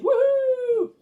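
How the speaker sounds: very high, loud whoop; gleeful whoop. A narrator's reading. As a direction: whoop with delight. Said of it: "they shouted".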